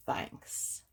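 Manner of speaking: soft, hushed story ending; whispered thanks. Acted.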